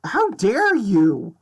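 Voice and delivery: slow, high-pitched indignation; mock outrage. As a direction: draw it out, playfully affronted. Playful outrage at demon.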